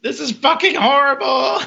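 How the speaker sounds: high-pitched retold wail; hammed-up dismay, pitched high; mock distress. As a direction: wail it as if stuck up in the sky. Flying character's complaint acted out.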